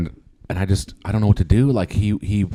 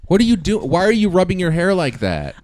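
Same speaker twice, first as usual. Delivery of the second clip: higher, louder bewildered yell; alarmed outburst; 9 semitones higher, 6 dB louder than usual. Acted. Husband yelling at the thug changing into a dress. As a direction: freak out at the weird intruder.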